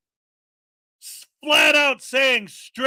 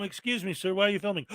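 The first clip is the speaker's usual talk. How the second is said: soft, lower-pitched polite query; mock polite questioner; 4 semitones lower, 8 dB quieter, faster than usual. Bystander asking the cameraman why he films, mock politely and softly. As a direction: play a meek, courteous bystander, quietly.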